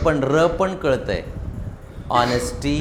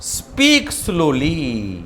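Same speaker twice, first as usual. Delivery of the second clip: Speak slowly stretched very slowly; slow speech; much slower than usual. Shown as a demonstration. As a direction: drag out speak slowly word by word. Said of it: "हळू बोला". (हळू, सावकाश बोललेलं)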